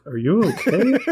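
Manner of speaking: high, swooping doubtful question; mock concern. Host voices Sulu hearing about the rabbit, mock-concerned. Voicing Sulu's reaction to Bones.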